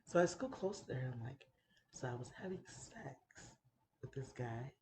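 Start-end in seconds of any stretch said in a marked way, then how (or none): 1.9-4.7 soft, hushed confession; low-key confiding. Retold or imitated. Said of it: "like, low-key".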